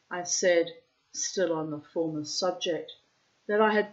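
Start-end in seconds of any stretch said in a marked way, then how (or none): none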